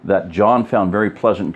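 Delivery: ordinary delivery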